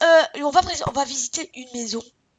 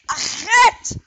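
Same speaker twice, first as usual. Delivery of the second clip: shouting; 7 semitones higher, 8 dB louder than usual. Real, not acted. Shouting at someone.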